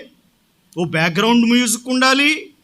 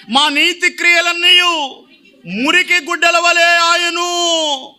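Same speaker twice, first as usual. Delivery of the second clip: fervent scripture reading; 6 semitones higher, slower than usual. Real, not acted. Pastor reads the Isaiah verse aloud to the church, crying out. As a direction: cry out the verse with a choked, pleading edge.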